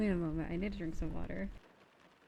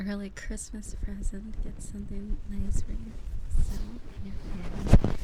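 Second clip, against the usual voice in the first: breathy whispered thanks; 10 dB louder than usual. Real, not acted. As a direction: whisper it close to the mic.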